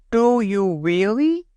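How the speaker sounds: put-on high, hopeful little-boy question; eager small boy. A narrator's reading. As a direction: beg to be told it's true. John asks again eagerly.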